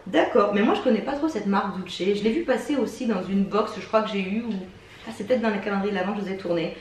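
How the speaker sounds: usual speaking voice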